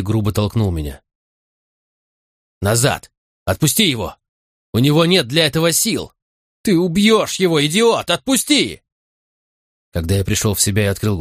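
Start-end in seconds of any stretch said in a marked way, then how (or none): 2.6-8.8 higher and higher, rising to a shout; furious shouting. Acted. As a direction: yell at him to let go. Verity orders him to let go.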